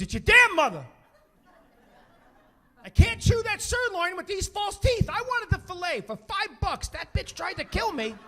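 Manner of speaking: high, loud, indignant rant; outraged old dad. Retold or imitated. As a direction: imitate an aggrieved father griping at full volume. Dad rants about wife in parking lot.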